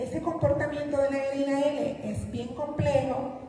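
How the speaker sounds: normal talking voice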